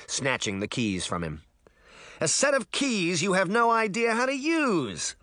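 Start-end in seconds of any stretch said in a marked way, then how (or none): 2.2-5.2 high-pitched, put-on boy's yell; bratty squabbling shout; shouted retort. A narrator's reading. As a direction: argue back at a high pitch. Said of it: "Puck shouted".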